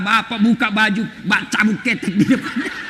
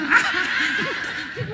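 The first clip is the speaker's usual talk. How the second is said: tawa tinggi; 11 semitones higher, 7 dB quieter than usual. Real, not acted. Audience-style laugh after joke.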